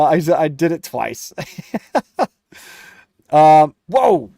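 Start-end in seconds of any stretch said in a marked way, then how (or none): 1.4-2.3 high laugh. Real, not acted. Laughing at chat joke. 3.3-4.3 loud, high startled whoa; surprised whoa. Real, not acted. A streamer reacting to a viewer's jab, surprised.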